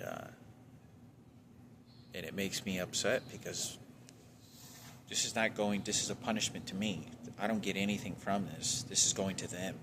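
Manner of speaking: ordinary talk, nothing marked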